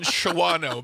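Shawano said stubbornly as shuh-WAH-no; phonetic pronunciation. Shown as a demonstration. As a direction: insist on Shawano with three syllables, shuh-WAH-no. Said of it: "I'm a child of phonics".

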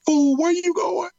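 high-pitched, drawn-out disbelief; incredulous mock question. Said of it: "They looked at me like".

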